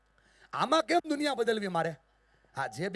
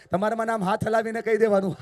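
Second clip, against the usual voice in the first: ordinary talk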